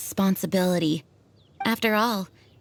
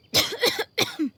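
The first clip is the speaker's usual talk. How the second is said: weak cough; 11 semitones higher than usual. Acted. Weakened Jean coughing.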